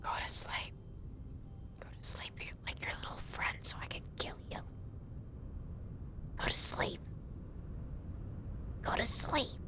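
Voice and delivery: soft taunting whisper. Real, not acted. Hunter to a fox she wants asleep, whispering. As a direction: lull the fox while creeping close. Coaxing prey to sleep quietly.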